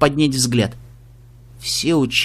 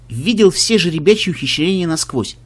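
plain reading voice

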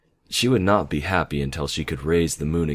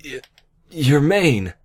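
high, loud, stunned exclamation; stammer, then blurt it out high; astonished, scrambling for words; dumbfounded stammer; 10 semitones higher, 5 dB louder, slower than usual. A narrator's reading. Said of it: "scrambling to find his voice".

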